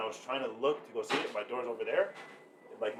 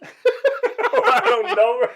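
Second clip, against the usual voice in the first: high, loud laughing protest; 14 semitones higher, 17 dB louder, much slower than usual. Real, not acted. Friend quizzed on what "eh" means, laughing helplessly. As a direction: burst out laughing and drag the words out.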